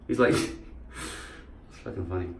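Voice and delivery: ordinary talk, nothing marked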